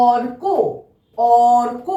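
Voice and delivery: careful teacher's অর্ক, r before k; model pronunciation. Shown as a demonstration. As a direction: say অর্ক with র sounded just before ক. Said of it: "এর উচ্চারণটা কি হবে".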